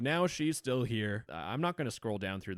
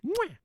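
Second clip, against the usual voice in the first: a big smacking air kiss; loud kiss sound; 5 dB louder than usual. Acted. Signing off the video with a big kiss. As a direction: blow the viewers a loud kiss.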